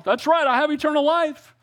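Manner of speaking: high, lifted, delighted reminder; bright burst of acted relief, pitched high; joyful realization. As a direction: exclaim it as good news just remembered. Acts out joyful reminder to oneself.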